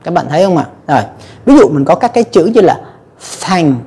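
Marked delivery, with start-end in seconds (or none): 3.4-3.8 "thank" with an airy, unvoiced TH; only breath on the TH of "thank"; voiceless TH. Shown as a demonstration. Teacher giving a TH example word, voiceless TH. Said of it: "không có rung dây thanh quản".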